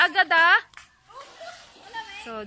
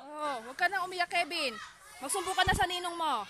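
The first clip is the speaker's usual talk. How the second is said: soft, gentle soothing words; comforting; 7 dB quieter than usual. Real, not acted. Adult soothing crying Kevin, soft comfort.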